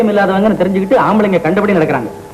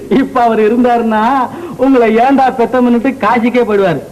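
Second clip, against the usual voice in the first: laughing, slow and higher teasing; mocking laugh; 5 semitones higher, slower than usual. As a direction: laugh at the old man while teasing. Nephew mocks uncle, laughing. (கேலிச் சிரிப்புடன் மெதுவாக)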